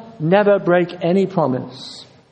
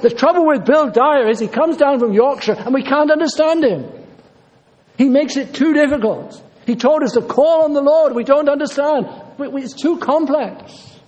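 mock complaining listener; 6 semitones higher than usual. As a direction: ham up the puzzled congregation member.

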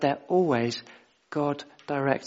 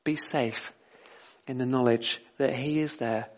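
gentle reassurance; 3 semitones lower, 5 dB quieter than usual. Real, not acted. Preacher reassuring congregation.

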